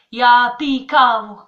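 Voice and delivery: slow, loud Czech-accented Ya pi kavo. Shown as a demonstration. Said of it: "faut le dire comme ça".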